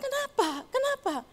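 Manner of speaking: high, urgent repeated question; worried asking. Retold or imitated. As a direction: ask again and again, alarmed. Asks sobbing grandson what's wrong. (bertanya cemas berulang-ulang)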